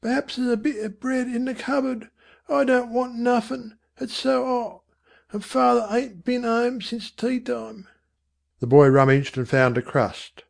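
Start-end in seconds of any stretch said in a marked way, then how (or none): 0.0-7.9 high, soft Cockney mother; listless mother. Acted. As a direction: speak wearily as the mother, pitched high. Voices the weary mother in story.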